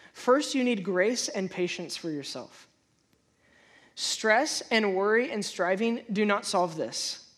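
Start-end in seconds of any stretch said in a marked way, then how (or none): none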